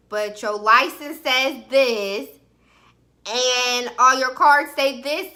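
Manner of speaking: "LICENSE SAYS THIS" slow and punched; emphatic confrontation. Retold or imitated. Woman confronting him over his name, THIS hit hard and slow. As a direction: lay out LICENSE and CARDS loudly, word by word.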